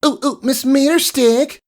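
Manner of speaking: very high, eager hand-raising cry; teacher's pet begging to be picked; eager exclamation. Acted. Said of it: "Diamond Tiara exclaimed".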